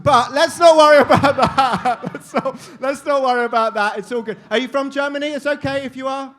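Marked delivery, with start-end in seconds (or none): 0.0-4.0 laughing through the words. Real, not acted. MC giggling after hearing a German accent. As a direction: crack up while brushing it off. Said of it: "You're speaking in a German accent".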